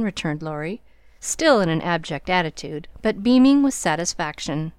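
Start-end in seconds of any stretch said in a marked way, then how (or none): none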